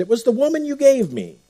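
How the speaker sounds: high, pleading excuse, mildly acted; Adam's excuse. Adam blaming the woman before God, mock whiny excuse. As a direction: pass the blame, a light hint of Adam. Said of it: "Adam was really good at the blame shift".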